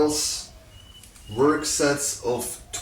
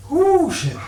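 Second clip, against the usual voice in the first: high whooping release after effort; breathless whoop; 13 semitones higher than usual. Real, not acted. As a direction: let out a high woo, then a muttered curse. Exhausted after finishing the set.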